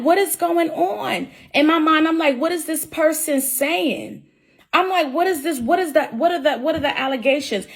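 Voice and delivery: high, baffled disbelief, reliving the meeting; bewildered. Retold or imitated. Telling viewers how the suspension hit her, baffled and high. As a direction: voice jumps up in confused shock. Retelling confused shock in meeting.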